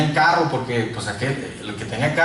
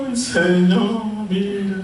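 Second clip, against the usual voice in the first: slow, higher, tearful-sounding "Ay, Señor"; mock crying plea; 5 semitones higher, much slower than usual. Young man crying to the Lord at the altar, imitated tearful plea. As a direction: retell a weeping plea at the altar, drawn out. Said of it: "vine al altar llorando".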